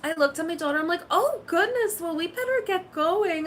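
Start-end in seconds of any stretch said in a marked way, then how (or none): 1.1-3.5 flustered mom. Retold or imitated. Flustered mom quoting herself.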